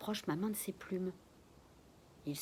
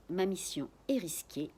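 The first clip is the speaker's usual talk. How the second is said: usual speaking voice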